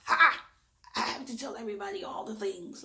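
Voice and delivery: hammed-up loud, high outburst; loudest person mimicked. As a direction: burst out like an overeager show-off. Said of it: "have to be the loudest person in the room".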